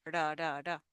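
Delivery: "Ra, ra, ra" with a single quick tongue tap; flapped R. Shown as a demonstration. Teacher showing the ro in oscuro, R as flap T. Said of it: "is like the flap T".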